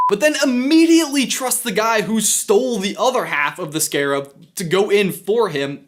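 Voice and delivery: energetic, punchy host delivery; presenter read. Acted. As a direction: sell the script to camera. Reads scripted line to camera.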